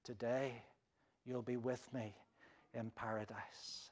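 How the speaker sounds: tender promise. Retold or imitated. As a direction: promise it quietly and kindly. Jesus answers the dying thief.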